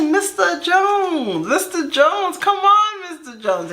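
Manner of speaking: high, drawn-out whiny appeal; hammed-up high-pitched coaxing; playful pleading. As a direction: stretch out the name, begging playfully. Retells pleading with Mister Jones.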